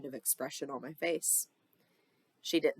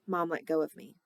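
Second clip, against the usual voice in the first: usual speaking voice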